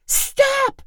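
very high shrieked stop; scream. A narrator's reading. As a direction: shriek it after him. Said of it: "Chloe screamed".

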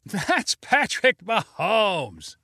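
slow, amused crowd call; laughing yell. Acted. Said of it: "yelled someone in the crowd with a laugh".

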